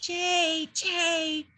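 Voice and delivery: high nasal jay call imitation; high nasal "jay" like a blue jay. Shown as a demonstration. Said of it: "here's the blue jay again".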